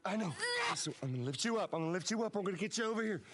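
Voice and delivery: high, loud, anguished urging rising to a scream; desperate pleading. Acted. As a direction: beg an injured child to hold on, building to a shout. Acted scene, father with wounded daughter.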